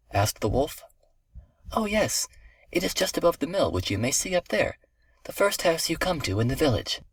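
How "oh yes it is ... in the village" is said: put-on high-pitched trusting child; little girl. A narrator's reading. Little girl gives the wolf directions, high innocent child voice.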